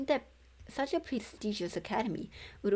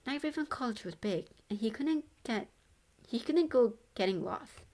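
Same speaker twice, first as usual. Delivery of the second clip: ordinary delivery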